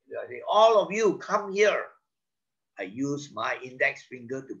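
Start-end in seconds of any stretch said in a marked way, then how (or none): 0.4-1.9 commanding. Acted. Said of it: "I point and command".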